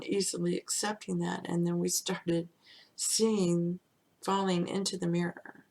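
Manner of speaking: usual speaking voice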